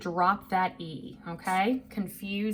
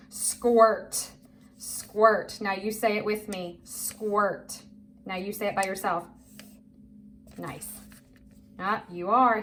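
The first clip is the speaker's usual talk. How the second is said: usual speaking voice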